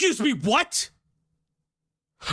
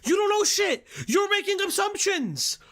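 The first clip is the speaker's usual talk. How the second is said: angry challenge; 6 semitones higher than usual. Real, not acted. Streamer angrily challenging the video.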